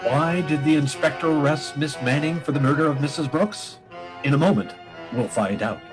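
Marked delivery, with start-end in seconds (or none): none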